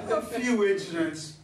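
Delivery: sheepish laughing concession. Acted. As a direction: brush it off with a laugh. Husband laughs off accidents.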